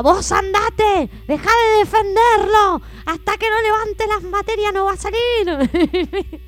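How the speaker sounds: high, slow, loud mock roar; imitated angry father. Father ordering mother to stop defending the boy, mock bellowing. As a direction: bellow each order, stretched out.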